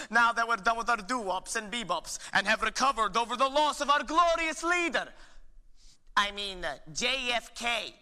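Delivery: stern teacher, building. Acted. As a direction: swell into fervent praise. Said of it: "Miss Hoffenpfeffer von Schnitzel McCarthy".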